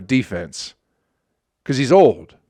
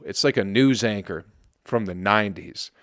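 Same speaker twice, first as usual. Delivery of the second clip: usual speaking voice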